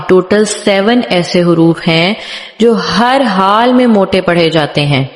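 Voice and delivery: ordinary talk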